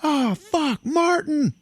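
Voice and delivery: very high shrieked alarm; hammed-up shriek; mock panicked cry. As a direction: cry out as a friend falls. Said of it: "everybody's like".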